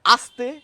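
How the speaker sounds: আস্তে loud with a crisp s and a rising end; dental s. Shown as a demonstration. Teacher singles out the word for students, loud and crisp. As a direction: call out আস্তে, sharp clear s. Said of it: "দন্ত শয়ের উচ্চারণ".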